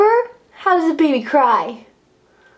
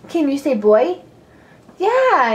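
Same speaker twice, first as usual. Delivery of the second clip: usual speaking voice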